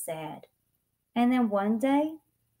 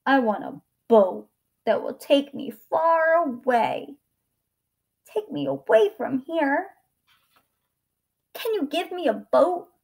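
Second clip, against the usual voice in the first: lightly voiced boy, pitch rising; pleading boy; 5 semitones higher, 7 dB louder than usual. A narrator's reading. Boy wanting to get away, mildly pleading. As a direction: plead a little higher and louder with each request.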